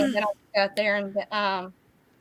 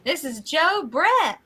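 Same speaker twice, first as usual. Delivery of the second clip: drawn-out station ID, pitch swooping; put-on radio call; slower than usual. Host signing on to the net, put-on radio call. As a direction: stretch out the call letters theatrically.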